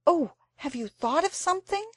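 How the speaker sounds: high, eager question; a girl hoping for an idea. A narrator's reading. Jane eagerly asks Anthea about her idea.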